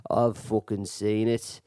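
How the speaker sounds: low, slow Irish butting-in; Irish accent. Retold or imitated. Irish guy butting in from the side, low Irish accent. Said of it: "the Irish guy comes in".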